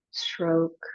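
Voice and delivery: ordinary talk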